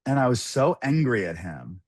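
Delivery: usual speaking voice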